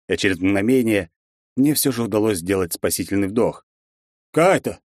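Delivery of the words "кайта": sharp, loud rebuke of the name; barely held anger. A narrator's reading. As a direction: snap his name in anger. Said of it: "плохо сдерживаемым гневом".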